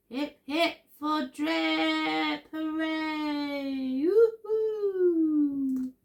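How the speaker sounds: high, drawn-out sing-song cheer; happy hooting. A narrator's reading. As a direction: cheer slowly in long, high hoots. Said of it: "the duckbills hooted happily".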